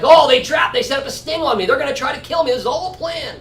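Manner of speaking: high-pitched mock alarm; imitated panic. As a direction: act out someone realizing they've been set up. Acting out the woman's panicked realization.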